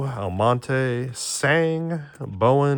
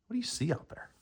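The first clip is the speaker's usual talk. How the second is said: higher, soft pet-talk voice; talking to the dog; 10 semitones higher, 11 dB quieter, faster than usual. Real, not acted. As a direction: coo at the dog by the window. Owner talking to his dog.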